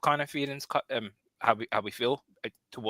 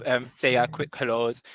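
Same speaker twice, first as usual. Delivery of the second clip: ordinary talk, nothing marked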